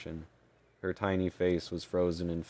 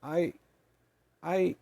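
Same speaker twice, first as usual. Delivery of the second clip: tiny fairy stammering; squeaky stammer; 11 semitones higher than usual. Acted. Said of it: "the creature stammered".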